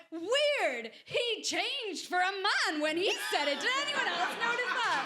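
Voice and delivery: high, loud announcement to the queue; loud mock call-out. Calling out line-skipper to the queue, loud and high. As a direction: shame him loudly for all to hear. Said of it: "I went".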